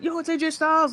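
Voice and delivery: high-pitched thrilled fan outburst; excited fan. Retold or imitated. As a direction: squeal it like a fan who can't believe it. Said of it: "I'm like".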